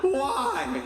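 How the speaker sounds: very high, slow, laughing wail; high drawn-out mock heartbroken whine; mock wailing boyfriend. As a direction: plead with his ex, stretched out and high. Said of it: "he's like".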